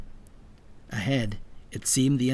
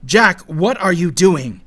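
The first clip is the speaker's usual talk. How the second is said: raised, loud reproach; loud, urgent question; yelled demand; 5 semitones higher, 10 dB louder than usual. A narrator's reading. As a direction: yell at the boy in disbelief. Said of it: "Conway yelled".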